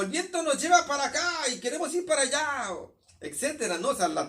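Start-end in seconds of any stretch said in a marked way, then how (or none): 0.0-2.7 calling out. Retold or imitated. Sailors shouting in the storm.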